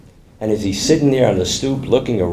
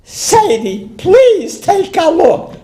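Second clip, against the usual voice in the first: very high, drawn-out plea; hammed-up squealed pleading; desperate pleading; much slower than usual. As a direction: beg your friend, voice pitched way up. Third plea to look.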